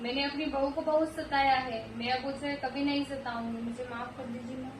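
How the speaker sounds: soft, fading plea for mercy. Acted. Mother-in-law begs the goddess for forgiveness, fading softly. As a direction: beg forgiveness, sinking to almost nothing.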